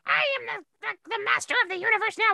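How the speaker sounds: high, fast gloating boast; gloating demon. Retold or imitated. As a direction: crow it high and quick like a petty villain. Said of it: "starts saying".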